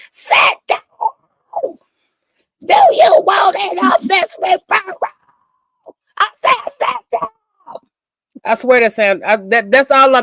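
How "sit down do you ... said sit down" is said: high shouting bus driver voice; Miss Crabtree. Retold or imitated. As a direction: bark it like a cranky cartoon bus driver. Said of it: "the bus driver from South Park?".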